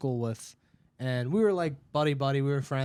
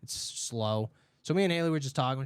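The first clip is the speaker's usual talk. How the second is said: normal talking voice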